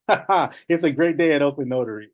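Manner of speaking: re-enacted cheerful greeting opened with a laugh; laughing phone greeting. Notary answers a client's call, re-enacting a laughing greeting. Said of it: "And I'm, like, laughing".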